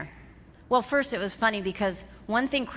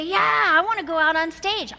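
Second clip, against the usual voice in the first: bright eager yes, pitched up; mock-eager audience member keen to go on stage; eager volunteer; 8 semitones higher, 5 dB louder than usual. Audience member volunteering for the stage, eager. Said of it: "some of you would say".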